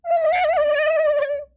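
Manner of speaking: high-pitched burst of laughter; high squeal laugh. Real, not acted. Streamer laughing at his failed impression, high squeal. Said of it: "my Reggie Rock impression".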